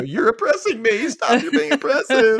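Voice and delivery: high, whining protest voice; mock whiny Sally.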